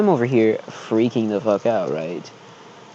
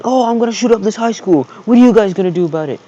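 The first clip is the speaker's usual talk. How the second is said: mocking the shooter; 8 semitones higher, 4 dB louder, faster than usual. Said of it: "outside in public saying".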